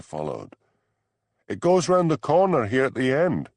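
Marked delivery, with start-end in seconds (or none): 1.5-3.5 loud call back down a cave passage; shouting back. A narrator's reading. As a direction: throw the words back over your shoulder, loud. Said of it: "she shouted back".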